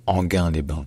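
Anguin-les-Bains with hard g, like French gain; Anguin-les-Bains, hard g, no i glide; correct pronunciation. Shown as a demonstration. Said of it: "il faut la lire comme le mot gain".

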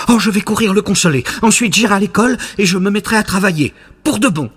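put-on high, quick excited boy voice; a lightly voiced eager little puppet rushing his words. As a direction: puppet boy full of good intentions, high and fast. Pinocchio eager to go home.

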